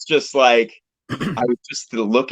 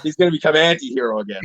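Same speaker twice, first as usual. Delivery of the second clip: retold alarm, pitched noticeably higher; mock dismay; 6 semitones higher than usual. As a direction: play up your own past panic a little. Retold dismay at rival's release.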